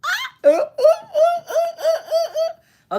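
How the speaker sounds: very high, loud squealing ooh-ooh with laughter; squealing at the live chat over a fellow Scorpio; excited squeal and hoots. Real, not acted. Gleeful shrieking hoots.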